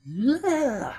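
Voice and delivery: high-pitched imitation of a kid's yell; mock kid's yell. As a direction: yelp it out high, one long held note. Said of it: "she hears this loud yell".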